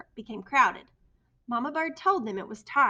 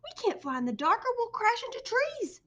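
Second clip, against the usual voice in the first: very high squeak; squeaky worried voice; 12 semitones higher, 4 dB louder than usual. A narrator's reading. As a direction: fret in a tiny high voice. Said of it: "Stellaluna squeaked".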